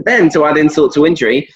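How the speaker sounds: usual speaking voice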